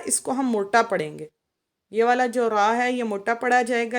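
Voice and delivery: normal talking voice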